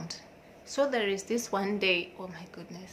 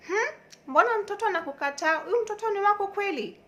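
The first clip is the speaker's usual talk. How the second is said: high, loud, quick-fire interrogation; nosy church mama; 13 semitones higher, 7 dB louder, faster than usual. Retold or imitated. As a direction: imitate a scandalised church auntie. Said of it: "how this mama of church normally talk".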